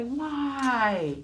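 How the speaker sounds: put-on child begging mommy in a store; whining child. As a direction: plead like a child denied a toy. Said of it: "the kid's gonna say".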